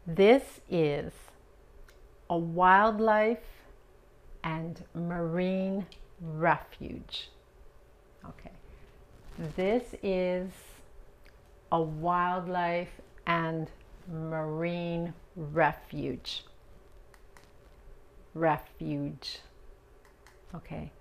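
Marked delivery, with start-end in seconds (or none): 0.1-7.3 "This is a wildlife and marine refuge" said slowly, word by word; slow model sentence. Shown as a demonstration. Teacher reading a model sentence to learners, slow. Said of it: "vamos a ir paso a paso". 9.5-19.4 "This is a wildlife and marine refuge" said slowly, word by word; slow model sentence. Shown as a demonstration. Teacher reading a model sentence to learners, slow. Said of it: "vamos a ir paso a paso".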